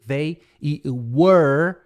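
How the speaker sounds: they and were said very slowly; they, were drawn out for the class; slow model words. Shown as a demonstration. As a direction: stretch each word for learners. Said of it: "They é eles".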